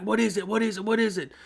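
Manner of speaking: imitating an alarmed dad rushing in; retold urgent demand for an answer; urgent questioning.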